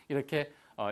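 normal talking voice

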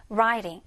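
"writing" with a weakened, r-like middle t; "writing" said like "rairing"; American pronunciation. Shown as a demonstration. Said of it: "중간에 알 발음이 이 알로 약화된다".

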